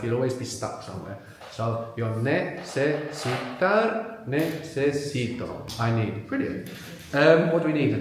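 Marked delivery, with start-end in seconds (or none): 2.1-4.2 "necesitar" broken into ne-ce-si-tar, slowly; slow, syllable by syllable. Shown as a demonstration. Teacher advising the tongue-tied learner, slow syllables. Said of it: "Syllable by syllable". 4.3-5.8 "necesito" drawn out as ne-ce-si-to; slow, syllable by syllable. Shown as a demonstration. Teacher advising the tongue-tied learner, slow syllables. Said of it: "Syllable by syllable".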